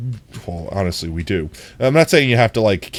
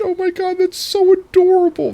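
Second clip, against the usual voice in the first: high-pitched gushing squeal; squealing with delight; 4 dB louder than usual. Real, not acted. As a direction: squeal it, totally charmed. Gushing over child's matching nails.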